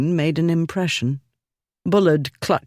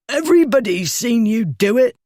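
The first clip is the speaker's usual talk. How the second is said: put-on louder, higher protest of a drunk; higher indignant bully; 8 semitones higher, 3 dB louder than usual. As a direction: play to the crowd. Winded bully appeals to onlookers.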